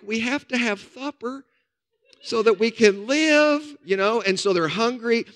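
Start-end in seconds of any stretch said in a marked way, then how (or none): 0.1-3.8 crowd's plea. Retold or imitated. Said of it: "all these people are like".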